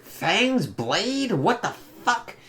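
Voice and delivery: high, incredulous squeak of surprise; shocked disbelief. Retold or imitated. Reacting to a daughter's fangs, stunned disbelief. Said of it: "I was like".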